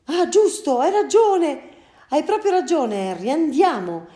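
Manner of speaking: high and loud, hurrying; sudden realization. Acted. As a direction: snap out of it and rush off. Father suddenly realizes his mistake. (padre che capisce di colpo, acuto e concitato)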